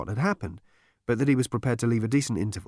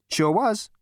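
cocky Zaphod; 10 semitones higher than usual. A narrator's reading. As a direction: brag like a smug hero. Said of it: "Zaphod answers".

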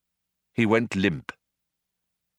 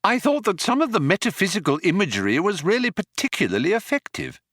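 higher, fast eager praise; bluffing Arthur; 11 semitones higher, faster than usual. Acted. Arthur bluffs praise for the Vogon's poem, fast. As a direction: rattle off the praise.

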